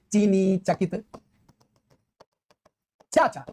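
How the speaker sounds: verse line spaced out word by word; unhurried teaching recital; slow recitation. Shown as a demonstration. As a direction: recite slowly to show the repeated sounds. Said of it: "तो जैसे single रिपीटेंस मारी".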